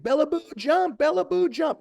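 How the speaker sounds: very high-pitched urging call. Retold or imitated. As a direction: re-enact cheering a child on to jump. Father calling daughter to jump.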